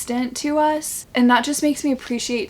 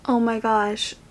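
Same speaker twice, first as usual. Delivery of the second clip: normal talking voice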